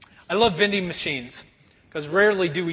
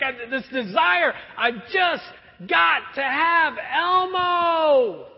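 high, slow shouted whine; desperate kid; 7 semitones higher, 3 dB louder, much slower than usual. Retold or imitated. Kid in store desperate for Elmo, shouting. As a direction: drag it out like a kid who must have it. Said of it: "He's like".

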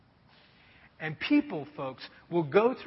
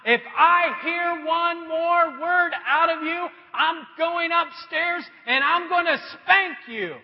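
stern threat; 7 semitones higher, slower than usual. Retold or imitated. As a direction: lay down the law. Father threatens child.

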